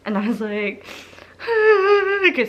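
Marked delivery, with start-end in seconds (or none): 1.4-2.5 high, wordless mock sobbing; imitated crying. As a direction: act out crying too hard to talk. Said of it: "still couldn't talk".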